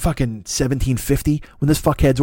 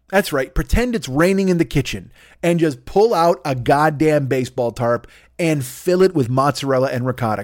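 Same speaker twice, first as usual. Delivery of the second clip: ordinary talk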